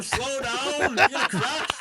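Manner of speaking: high, loud retold yell; mock panicked warning. Retelling a warning to the driver before crashing, mock panicked. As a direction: shout the warning, laughing through it.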